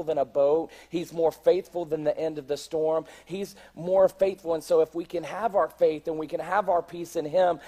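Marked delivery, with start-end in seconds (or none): none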